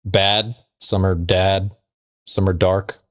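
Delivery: ordinary delivery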